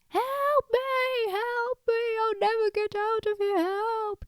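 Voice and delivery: very high-pitched continuous wail; hammed-up drowning bunny howl; mock panicked shouting. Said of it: "all the shouting that Billy was making".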